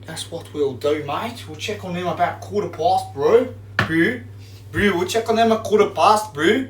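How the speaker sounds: high-pitched, playful South African impression; South African accent. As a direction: do your best South African bro. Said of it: "That's my best South African accent".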